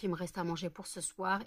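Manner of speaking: normal talking voice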